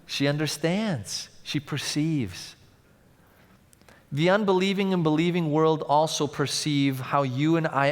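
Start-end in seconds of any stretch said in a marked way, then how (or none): none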